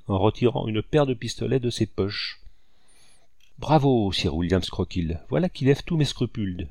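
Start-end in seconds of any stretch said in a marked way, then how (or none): none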